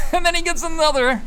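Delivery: very high, loud delighted cry; excited squeal. Real, not acted. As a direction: squeal as it happens again. Another crit lands.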